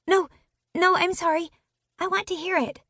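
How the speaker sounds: high, begging little sister voice; pleading. Acted. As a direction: plead to be allowed to hear it. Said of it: "I pleaded".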